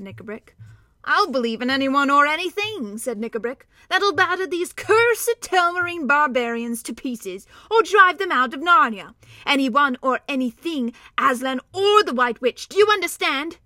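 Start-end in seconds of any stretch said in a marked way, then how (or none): 1.0-3.0 louder, harsher dwarf voice; a bitter dwarf spoiling for a fight; fierce dwarf. Acted. Knickerbrick's bitter outburst. 3.9-13.6 louder, harsher dwarf voice; a bitter dwarf spoiling for a fight; fierce dwarf. Acted. Knickerbrick's bitter outburst.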